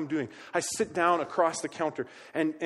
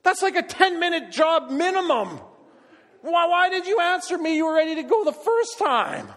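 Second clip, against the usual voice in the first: exasperated; 10 semitones higher, 7 dB louder than usual. Real, not acted. Exasperated rant about wife.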